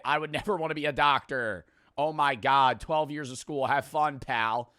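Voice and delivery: ordinary talk, nothing marked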